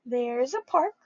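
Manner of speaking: usual speaking voice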